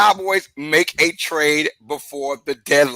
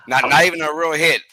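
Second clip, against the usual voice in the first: ordinary talk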